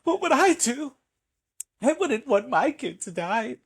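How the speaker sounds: high, distressed plea; parent's anguish. Acted. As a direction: mock-desperate parent.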